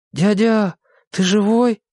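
timid call. A narrator's reading. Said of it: "несмело позвал Виталик".

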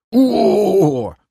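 long, high-pitched drawn-out moan; agonized wailing groan; gut-deep groan. A narrator's reading. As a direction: groan from the belly, doubled up in pain. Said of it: "утробно застонал Крей".

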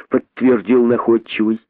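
plain reading voice

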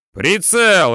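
loud, high one-word yell. A narrator's reading. Crewman demanding the sighting, loud high yell. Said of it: "орёт Вася".

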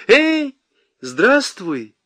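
high, loud friendly call; bright shouted greeting to the lieutenant. A narrator's reading. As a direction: call out a cheerful hello across the square. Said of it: "крикнула ему Нюра".

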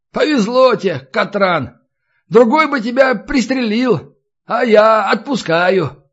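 high-pitched jovial taunt; mock-generous cheer. A narrator's reading. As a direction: let him go with breezy magnanimity. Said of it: "Весёлость его улетучилась". (весело, с насмешливым великодушием)

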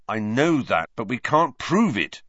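frustrated, rising in pitch; bitter, emphatic retort. A narrator's reading. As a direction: concede it bitterly, with growing force. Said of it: "said Wood bitterly".

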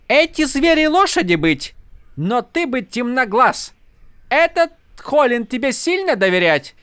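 high, broken foreign speech; haughty fake Horneater noble; mock Horneater accent. A narrator's reading. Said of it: "имитация рогаедки".

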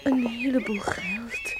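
awed whisper, slow. Acted. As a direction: breathe it out in wonder. Awed by his savings. (vol ontzag gefluisterd)

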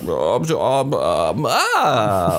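drawn-out mock umming, swooping into an "ah"; nachgeahmtes Überlegen. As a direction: imitate someone humming and hawing. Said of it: "Und dann hat Johannes gesagt". (nachgeahmtes, gedehntes Ähm und Ah)

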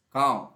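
loud cawing cry; crow caw. A narrator's reading. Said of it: "कौवे ने उत्तर दिया".

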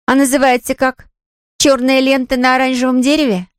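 high-pitched teasing sister; older sister. Acted. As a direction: taunt a younger brother, pitched high. Сестра насмешливо расспрашивает брата. (старшая сестра, высоким голосом)

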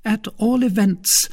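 high, earnest woman's voice; serious Milady, pitched high. A narrator's reading. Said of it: "said Milady seriously".

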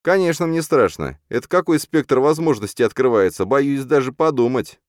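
high, sarcastic mock fear. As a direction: pretend to be scared, ironically. Hero's sarcastic fake fear. (саркастично)

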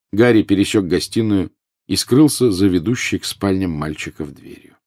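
usual speaking voice

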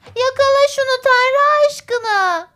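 very high, loud, stretched-out begging; urgent pleading. A narrator's reading. Andrew begs his brother to catch it, pleading. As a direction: beg loudly in a piping child's voice. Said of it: "diye yalvardı Andrew".

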